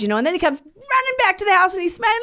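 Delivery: ordinary talk, nothing marked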